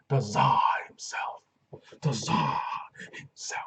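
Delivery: whispered, slow and reverent; awed whisper of the Tsar; hushed hype. Acted. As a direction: breathe the nickname like a legend. Hyping wrestler's nickname.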